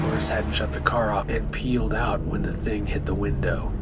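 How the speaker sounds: ordinary delivery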